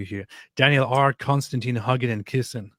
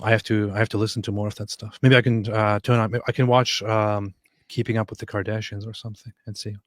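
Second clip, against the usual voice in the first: ordinary talk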